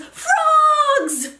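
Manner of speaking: high shrill scream. Acted. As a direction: shriek it as she falls. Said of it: "She slips and screams".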